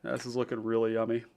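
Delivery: talking while eating; fast, through a mouthful of salad; mouth full. Real, not acted. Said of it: "turns into, like, an A S M R mukbang".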